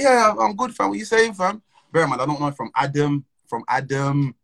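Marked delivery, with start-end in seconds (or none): 0.0-1.6 higher, louder breezy reply; replaying his own reply. Answering a stranger he pretends to know, breezy and friendly. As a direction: sound casually friendly, faking that you know him. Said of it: "So I'm like".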